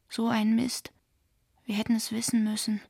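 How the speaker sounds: ordinary delivery, nothing marked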